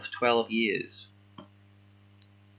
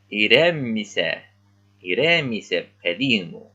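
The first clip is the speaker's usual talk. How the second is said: slow, careful teacher line; slow retake; 4 dB louder, slower than usual. Shown as a demonstration. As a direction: read it again, deliberate and soothing. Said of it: "Let's try it again".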